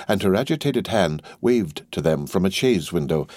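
usual speaking voice

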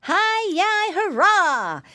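high, loud little-piggy cheer; gleeful squeal. A narrator's reading. Piggy boy cheers about the vacation, squealing with glee. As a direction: squeal out a hurrah with delight. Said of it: "he squealed and jumped".

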